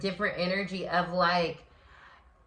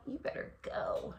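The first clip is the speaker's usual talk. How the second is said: whispered tease; playful whisper; 13 dB quieter than usual. Real, not acted. Reader whispers encouragement to Leo, teasing. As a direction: breathe it like a secret cheer.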